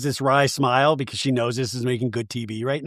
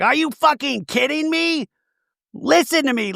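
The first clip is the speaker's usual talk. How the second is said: mock outraged demand; 11 semitones higher than usual. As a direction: demand attention in hammed-up disbelief. Retold quarrel.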